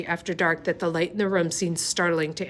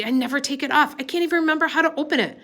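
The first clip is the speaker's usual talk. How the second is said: higher and fast, rushing the words in panic; frantic; 9 semitones higher, faster than usual. A narrator's reading. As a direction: blurt it out, a girl close to tears. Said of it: "Ellen said frantically".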